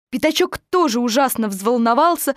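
ordinary delivery

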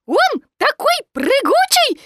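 very high, loud little squeak; Piglet. A narrator's reading. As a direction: explain in a tiny squeaky voice. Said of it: "сказал Пятачок".

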